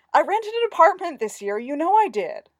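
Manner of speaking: loud, high, insistent whine; whining. A narrator's reading. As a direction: whine loudly in self-defence. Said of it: "Jeffrey whined".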